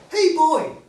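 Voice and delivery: high, loud hail across the street; loud high hey to a street boy; calling out. Acted. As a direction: call the boy over.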